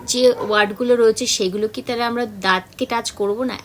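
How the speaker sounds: ordinary delivery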